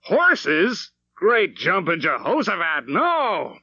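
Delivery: high, loud exclamation; wonderful shout. A narrator's reading. Father shouting his refusal to his wife, high and loud. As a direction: shout it out, delighted defiance. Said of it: "that wonderful shout".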